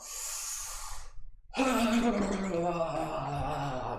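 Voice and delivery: high falling sigh out; long sighing exhale. Shown as a demonstration. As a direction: let the breath fall out in a sigh. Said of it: "deep inhale".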